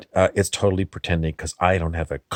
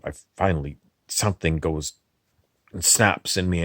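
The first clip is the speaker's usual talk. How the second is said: usual speaking voice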